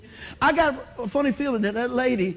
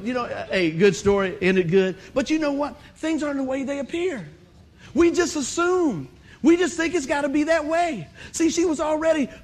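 ordinary delivery

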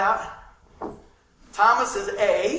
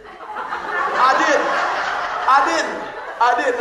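laughing; 4 semitones higher, 7 dB louder, much slower than usual. Real, not acted. Laughing repeats to congregation.

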